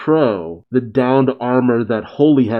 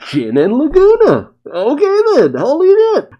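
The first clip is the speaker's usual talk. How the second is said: thrilled outburst; 6 dB louder than usual. Real, not acted. Thrilled reaction to heroes arriving.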